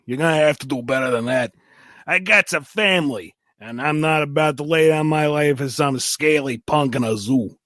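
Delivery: tough Brooklyn mouse voice; Brooklyn accent. Acted. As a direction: talk like a Brooklyn wise guy. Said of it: "It replies in a heavy Brooklyn accent".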